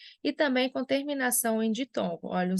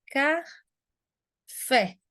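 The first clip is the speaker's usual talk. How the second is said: "café" slowly as ca, long pause, FÉ; split into syllables; 3 semitones higher than usual. Shown as a demonstration. Teacher showing the tonic syllable of "café", long pause before a stressed FÉ. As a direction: break "café" in two, stressing the last syllable. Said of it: "é o fé".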